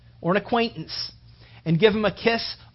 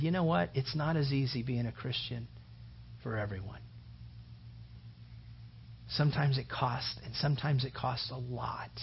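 hushed lesson; 5 semitones lower, 11 dB quieter than usual. Retold or imitated. God's lesson, dropping to hush.

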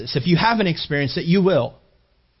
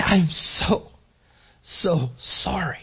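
breathy, tear-choked apology; acted tearful compassion, slowing at the end; tearful sympathy; 4 dB quieter, slower than usual. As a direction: draw out the sorrow, nearly crying. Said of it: "I mean, even with tears".

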